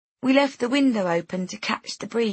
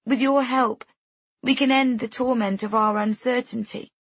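higher-pitched, restrained grieving mother; a bereaved mother's appeal, pitched up; grief-stripped voice; 5 semitones higher than usual. A narrator's reading. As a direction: plead with worn, understated grief. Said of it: "her voice stripped bare and scoured by grief".

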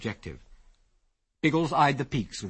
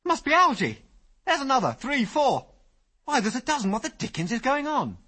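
very high, louder amazed exclaiming; excited; 14 semitones higher, 5 dB louder than usual. A narrator's reading. Ginger counting lights in the sky, rising excitement. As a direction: tumble out the count, more and more astonished.

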